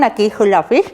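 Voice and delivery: talking around a pencil held between the teeth; muffled demo speech with a pencil in the mouth. Shown as a demonstration. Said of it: "Lo voy a hacer sin miedo al ridículo".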